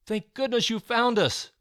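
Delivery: high, loud relieved outburst; rescued girl thanking her friend; relieved disbelief. A narrator's reading. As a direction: gasp it out, hardly believing it. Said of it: "Christy said in disbelief".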